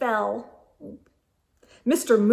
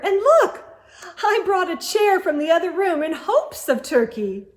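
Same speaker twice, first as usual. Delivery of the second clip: delighted hostess; 7 semitones higher, 5 dB louder than usual. A narrator's reading.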